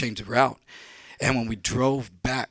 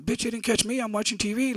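much higher, dismissive; scoffing; 11 semitones higher than usual. Retold or imitated. Narrator scoffing at Freddie on the phone, dismissive. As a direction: brush him off.

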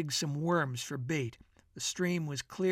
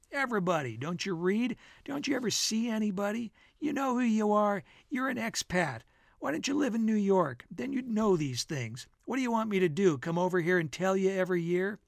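animated, higher-pitched mock lecture; hectoring friend; 4 semitones higher than usual. Bill lecturing his expatriate friend, hectoring and animated. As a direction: pepper him with emphatic, teasing questions.

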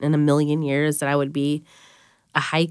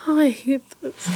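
tearful; 9 semitones higher than usual. Real, not acted. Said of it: "I'm getting emotional now".

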